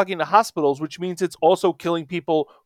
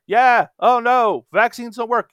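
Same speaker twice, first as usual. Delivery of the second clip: slow, higher sneering mimicry; mocking voice; 6 semitones higher than usual. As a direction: parrot them with scorn. Mocking anti-vaxxers.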